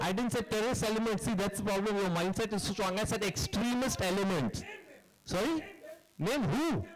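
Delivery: a director snapping back at a hostile press question; indignant protest. Real, not acted. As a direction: push back hard, offended. Director rebuts a reporter's accusation.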